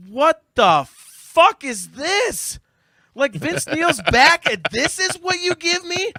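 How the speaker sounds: high, loud, drawn-out incredulous cry; outraged disbelief. Retold or imitated. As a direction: retell it as exasperated, over-the-top outrage. Retells his outraged reaction to performance.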